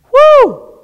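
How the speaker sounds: sudden high whoop; loud high whoop. Real, not acted. Preacher whooping at the withered fig tree, loud and high. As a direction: let out a whoop of amazement.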